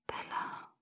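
barely audible breathed name; whisper. A narrator's reading. Sick boy says her name before hanging up, whispering. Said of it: "Er flüsterte meinen Namen".

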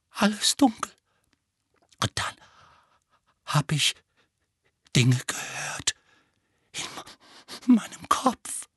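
halting, whispery fragments with long pauses; heiser schaudernd. A narrator's reading. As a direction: stammer it out, shuddering. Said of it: "sagte Dudley heiser und erschauderte". (stockend, heiser und verängstigt)

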